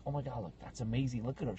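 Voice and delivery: low, soft marveling; hushed aside. Retold or imitated. Visitor nudging a friend about a costume, hushed and amazed. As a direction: keep your amazement under your breath. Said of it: "I tapped my friend on the shoulder".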